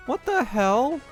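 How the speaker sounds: ordinary talk, nothing marked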